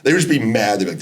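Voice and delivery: ordinary delivery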